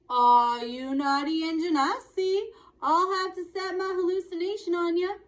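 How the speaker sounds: loud, high mocking cowboy voice; brash cowboy mocking an opponent; cowboy taunt. A narrator's reading. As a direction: jeer at him like a brash cowboy. Boone taunting Little Bear.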